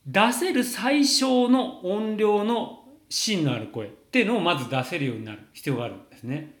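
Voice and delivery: normal talking voice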